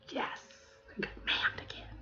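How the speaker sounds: hushed, secretly delighted whisper; gleeful whisper. Acted. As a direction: whisper it like a private triumph. Mimes inward glee at being ma'amed.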